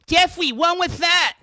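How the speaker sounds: high put-on whiny Jeffrey voice; mock Jeffrey voice. Streamer mocking Jeffrey from the forum in a high put-on voice. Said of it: "not all Jeffreys talk like that".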